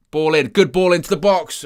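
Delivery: rising, loud and eager as the cross comes in; excited commentary. Real, not acted. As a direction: call the ball in with a sudden surge of excitement. Cross whipped into the box.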